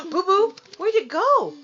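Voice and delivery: gasping, high and loud; startled squeal at the dog; surprised. Real, not acted. Gasps at the hair.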